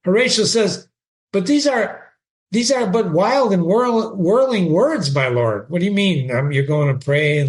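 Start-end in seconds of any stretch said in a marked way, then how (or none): 1.3-5.7 reading Shakespeare aloud with feeling; dramatic reading. Acted. Teacher reading Horatio to the class, dramatic reading. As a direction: give Horatio's line a lively read.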